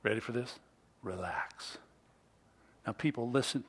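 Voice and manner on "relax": low, hushed, easy; soft invitation to relax. Shown as a demonstration. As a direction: say it softly, inviting calm.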